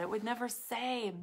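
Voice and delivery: normal talking voice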